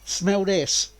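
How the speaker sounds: a hint of George, repeated for laughs; mock George line. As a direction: parrot George's line back with a grin. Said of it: "say that, George".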